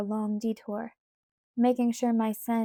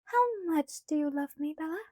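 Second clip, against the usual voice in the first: put-on light, higher girlish pleading voice; Alice's voice; 6 semitones higher than usual. A narrator's reading. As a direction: voice Alice higher, coaxing Bella.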